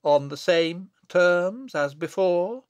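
slow, loud, pointed question; significantly. Acted. Wizard renews his bargain with the abbot, significantly. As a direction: stretch each word with heavy meaning. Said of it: "he added significantly".

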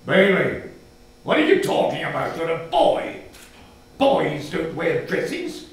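a father cutting the talk short; stern father. Acted. Father rebukes child about dresses, loud and stern.

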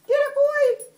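very high, loud cheering on; excited urging. Real, not acted. Mommy sending River after a running squirrel, excited urging. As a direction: whip a pet into a chase. Egging pet on in a chase.

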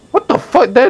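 high, startled disbelief; astonished, high-pitched outburst; shocked discovery. Real, not acted. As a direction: burst out high on spotting them. Spots missing teammates in game.

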